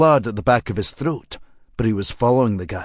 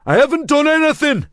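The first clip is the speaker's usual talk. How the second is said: put-on high, loud, furious shout in a rough Geordie accent; a cornered man yelling his innocence, raging; harsh, enraged local accent; 5 dB louder than usual. A narrator's reading. Cornered suspect shouting at Marshall, harsh enraged local accent. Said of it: "Local accent, harsh and full of rage".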